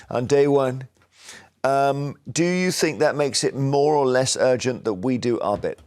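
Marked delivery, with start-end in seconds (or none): none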